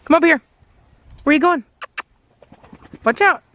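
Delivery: high coaxing call to a dog; dog-talk voice. Real, not acted. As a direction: call the puppy over, sweetly. Calling to new dog.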